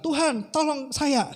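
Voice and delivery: high, slow acted plea for help; pleading prayer. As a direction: draw out a high mock cry to God. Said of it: "Lalu dia bilang". (seruan memohon tolong)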